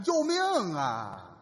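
shout for help. Shown as a demonstration. Partner demonstrating what the wife should yell, mock shout. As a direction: show the right cry, stretched out. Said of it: "喊这个".

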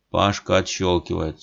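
usual speaking voice

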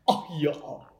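sudden choked gasp; choking. Real, not acted. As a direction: caught choking on a sip. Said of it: "Perdón, me atraganté".

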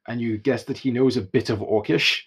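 ordinary delivery

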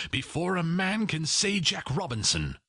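high, jolly urging; Fezziwig. A narrator's reading. Master urging on his apprentices, jovial and high. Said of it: "cried old Fezziwig".

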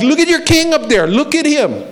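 high, sneering retold taunt; mock him from below the cross, pitched well up; a preacher imitating a jeering crowd. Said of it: "mocking him".

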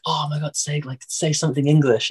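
retold gushing excitement; excited imitation. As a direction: imitate an excited fan asking a favour. Retelling excited strangers hearing his accent.

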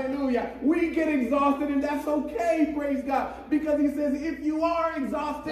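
high, urgent pulpit voice; impassioned preaching. Real, not acted. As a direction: preach it so everyone must hear. Preaches at full force.

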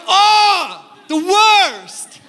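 high, loud mock outrage; playfully yelling in offended disbelief; mock outraged shout. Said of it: "And I'm like".

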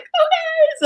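very high, tearful okay. Retold or imitated. Woman agreeing with her fiance, tearful squeak. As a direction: squeak it out through tears. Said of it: "I was like".

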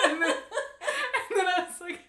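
high, slow, laughing hard; cracking up mid-sentence. Real, not acted. Wife telling co-host the prank, cracking up.

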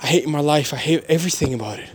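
ordinary talk, nothing marked

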